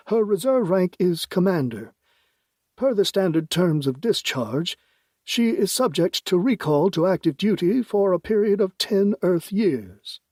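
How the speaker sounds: lightly voiced president. DeAndre speaks.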